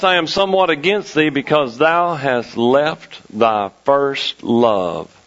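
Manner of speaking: low, weighty scripture quote; solemn rebuke. Acted. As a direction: let the pitch sink gravely to the last words.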